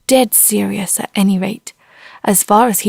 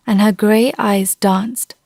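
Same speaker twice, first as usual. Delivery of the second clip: ordinary delivery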